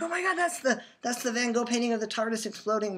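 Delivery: high-pitched excited exclamation. Retold or imitated. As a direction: reenact a thrilled discovery, pitch way up. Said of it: "how excited I was".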